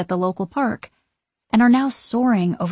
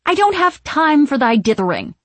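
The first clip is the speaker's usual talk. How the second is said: high, loud, haughty impatience; piercing, irritated witch voice; snapped; 7 semitones higher, 7 dB louder than usual. A narrator's reading. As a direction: scold them as an imperious witch. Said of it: "snaps Winifred".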